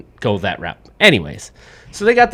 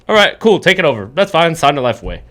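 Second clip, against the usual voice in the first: breezy, higher-pitched rubber-stamp approval; hammed-up offhand agreement; mock careless judge; 5 semitones higher, 4 dB louder than usual. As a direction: imitate a judge waving it through.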